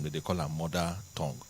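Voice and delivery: usual speaking voice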